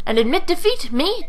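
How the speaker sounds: high-pitched mock indignation; indignant disbelief. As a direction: scoff at the very idea, pitch jumping. Defiant character in battle dialogue.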